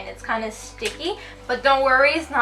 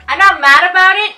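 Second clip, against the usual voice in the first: mock indignant; 7 semitones higher, 13 dB louder, faster than usual. Mock protest about the palette.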